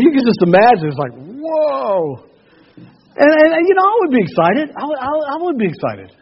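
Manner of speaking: high, loud and thrilled; preacher picturing the disciples' joy, excited; excited preaching. Acted. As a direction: let the excitement burst out.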